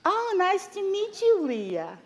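high, delighted Yiddish-mama lilt; high-pitched, swooping Yiddish mother. Acted. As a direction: coo over a new acquaintance in a Yiddish singsong. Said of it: "these two Yiddish mamas".

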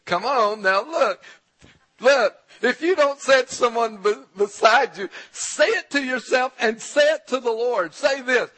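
loud, high-pitched preacher's urging; rousing exhortation. Real, not acted. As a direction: push them hard, voice up and forceful. Exhorting congregation to declare it louder.

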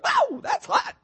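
sudden very high squeak of a frog; frog yelping at heat. Retold or imitated. Pastor voicing the frog in hot water, high squeaky yelp. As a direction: jump the voice way up like a startled frog. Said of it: "It goes".

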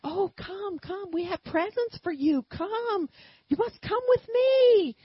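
inviting the crowd toward presents, sweetly coaxing; coaxing invitation. Retold or imitated. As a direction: lure them like kindergartners.

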